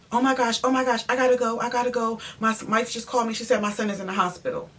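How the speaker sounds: high-pitched, hurried excuse; mock-panicked painter. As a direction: imitate a man frantically begging off. Acts out painter's frantic excuse.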